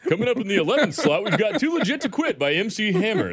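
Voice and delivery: loud, high, fast radio DJ voice. Pretend. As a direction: hype it up like a radio DJ. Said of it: "you use your news voice".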